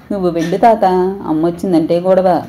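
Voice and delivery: ordinary delivery, nothing marked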